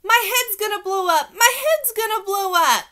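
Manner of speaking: put-on very high, loud child's alarm; panicked yell. A narrator's reading. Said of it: "I yelled at the nurse".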